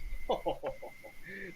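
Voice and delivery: acted knowing chuckle. Sergei asked about songs on the Count, chuckling. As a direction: chuckle in character before replying. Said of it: "So he probably chuckles".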